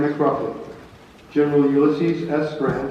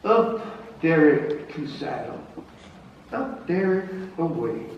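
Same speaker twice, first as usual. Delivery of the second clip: tipsy recitation; 2 semitones higher, 3 dB quieter, slower than usual. Acted. Grant reciting poem.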